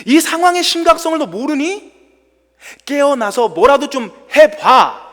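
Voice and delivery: loud, high reproach; accusing disciples. Acted. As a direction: scold the sleeping teacher in exasperation. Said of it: "이런 뉘앙스인 거예요".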